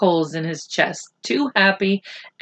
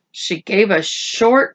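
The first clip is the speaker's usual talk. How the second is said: usual speaking voice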